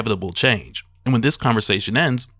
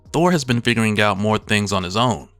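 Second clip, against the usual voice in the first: ordinary talk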